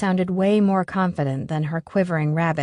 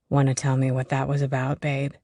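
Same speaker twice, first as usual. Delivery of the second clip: gentle; 5 semitones lower than usual. A narrator's reading. Dan gently.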